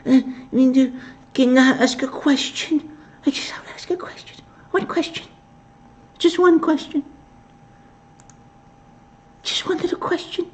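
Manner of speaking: very high, breathless whispered falsetto; timid mock reporter. Reporter timidly begging to ask one question, mocked in high whisper. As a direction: ask meekly, as if afraid to bother anyone. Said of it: "it's like".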